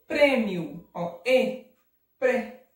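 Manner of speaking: Prêmio with loud closed PRÊ. Shown as a demonstration. Teacher isolating the vowel, loud closed ê in Prêmio. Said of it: "acento circunflexo".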